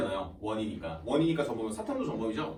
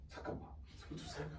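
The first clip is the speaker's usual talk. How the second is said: startled whisper; 13 semitones higher, 17 dB quieter than usual. Retold or imitated. Acting a freshman's shocked discovery.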